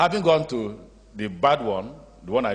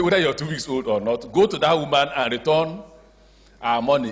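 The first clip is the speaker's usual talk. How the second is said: louder, higher firm command; stern governor rebuke; stern order; 5 semitones higher, 5 dB louder than usual. Retold or imitated. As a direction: lay down the law to her. Orders headmistress to refund.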